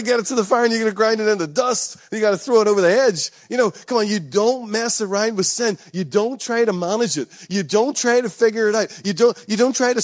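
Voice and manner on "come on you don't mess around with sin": normal talking voice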